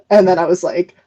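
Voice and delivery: ordinary talk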